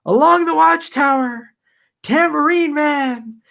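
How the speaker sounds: high, loud crowd yells of song titles; retold shouting. Fan yelling old song requests at [name], high and loud. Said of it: "we kept shouting".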